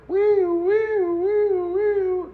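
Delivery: high-pitched wailing "wee" siren noise; imitated ambulance siren. Storyteller imitating the approaching ambulance, siren wail. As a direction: mimic a siren, very high and drawn out. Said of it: "and I hear".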